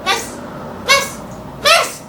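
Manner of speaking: escalating classroom call; pestering pupil. Acted. Pupil pestering for teacher's attention.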